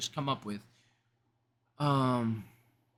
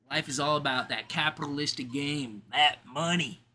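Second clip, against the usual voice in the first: sarcastic. Pretend. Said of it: "you guys understand that that's sarcasm".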